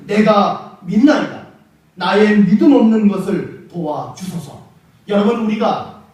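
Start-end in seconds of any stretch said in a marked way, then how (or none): 0.1-4.7 loud, slow, fervent confession; fervent cry. Retold or imitated. Father changing heart before Jesus, loud fervent cry. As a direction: cry out your faith. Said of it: "뭐라고 얘기하느냐 하면".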